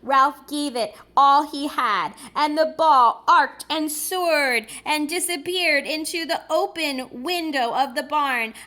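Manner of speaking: ordinary delivery, nothing marked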